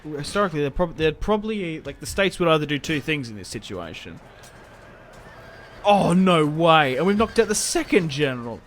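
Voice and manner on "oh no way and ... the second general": real astonished cry; delighted disbelief. Real, not acted. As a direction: marvel at the lucky hit. General knocked out.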